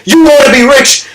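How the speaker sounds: high shouted question; acted full-force demand, pitched high; intense challenge. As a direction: fire the question at the kid. CEO challenges kid again.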